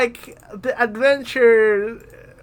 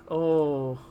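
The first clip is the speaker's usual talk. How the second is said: ordinary delivery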